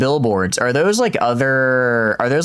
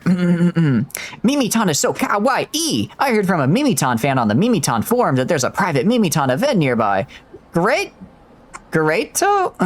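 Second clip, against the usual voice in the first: anime fanboy voice; 5 semitones higher than usual. Acted. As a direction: ham up a starry-eyed fanboy reading the line. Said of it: "my best fanboy voice here".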